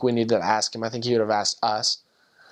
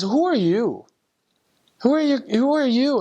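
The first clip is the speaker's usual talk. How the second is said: rising much higher and louder with each question; building emotional plea; 11 semitones higher, 4 dB louder than usual. Retold or imitated. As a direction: beg to know, overwhelmed and tearful. Tearful investigator asks repeatedly.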